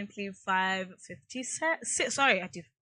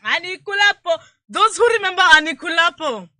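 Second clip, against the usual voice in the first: excited; 8 semitones higher, 13 dB louder than usual. Real, not acted. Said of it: "the way I said Anikulapo".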